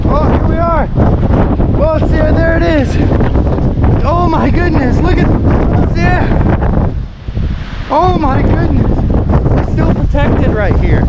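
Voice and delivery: loud, high, thrilled exclamations; excited shouting. Real, not acted. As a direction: shout in amazement over the wind. Seventy mile an hour wind.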